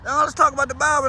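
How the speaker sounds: high, loud and insistent, pressing the gospel; pushy preacher voice. Retold or imitated. Pushy believer chasing people down.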